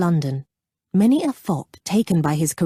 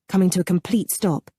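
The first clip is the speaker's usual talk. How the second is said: ordinary delivery, nothing marked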